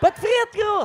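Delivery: stoned guy. Retold or imitated. Stoned guy voiced in story.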